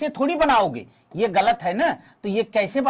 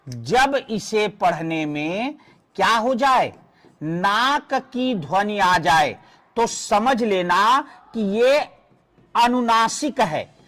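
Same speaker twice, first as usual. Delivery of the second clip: slow teaching voice; slower than usual. Shown as a demonstration. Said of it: "teacher repeats the rule".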